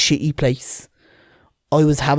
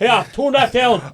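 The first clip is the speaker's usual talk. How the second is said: higher, slow bossy dad; imitating dad; 8 semitones higher, slower than usual. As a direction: call out an order as dad, drawn out. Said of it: "My dad says".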